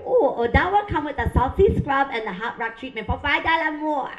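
mimicked Vietnamese nail lady sales pitch; Vietnamese accent. Nail lady explaining the pricier pedicure, Vietnamese accent. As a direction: upsell in a put-on Vietnamese accent. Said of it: "I love their accent".

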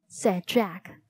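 plain reading voice

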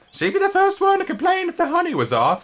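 very high, loud indignant insect voice. A narrator's reading. Third spindlebug complaining, very high indignant voice. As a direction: huff about her hypocrisy. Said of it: "said the third".

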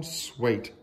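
usual speaking voice

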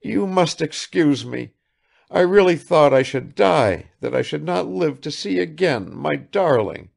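overflowing relief; mother telling how she feared; tearful. Acted.

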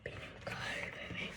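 whispered with feeling; whispering. Real, not acted. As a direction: hush it, heartfelt. Hushed reverent line.

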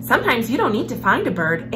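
usual speaking voice

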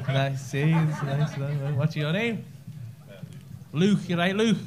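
ordinary delivery

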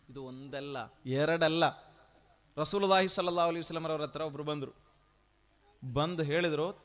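ordinary delivery

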